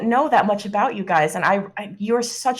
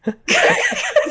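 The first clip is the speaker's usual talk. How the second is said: high burst of laughter; cracking up; loud real laugh; 7 dB louder than usual. Real, not acted. Player laughs at scene.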